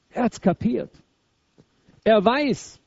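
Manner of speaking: ordinary talk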